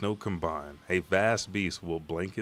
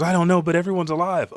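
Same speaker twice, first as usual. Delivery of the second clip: relieved shout; 10 semitones higher, 9 dB louder, faster than usual. Retold or imitated. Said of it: "And he goes".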